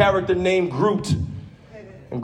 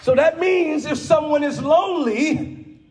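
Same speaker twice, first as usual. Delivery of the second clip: full-force preaching; 7 semitones higher than usual. Acted. Preacher to his church, calling out with full force. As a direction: preach it hard to the congregation.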